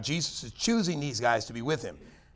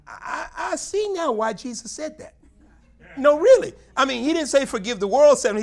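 high, loud, laughing emphasis; half laughing, fervent exclamation; sermon peak; full-force preaching; 12 semitones higher, 10 dB louder than usual. Acted.

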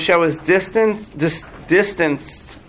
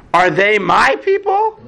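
angry Moshe; 4 semitones higher, slower than usual. Acted. Moshe retorting to God, angry. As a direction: retort to God in mock outrage. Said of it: "this is like the angry Moshe".

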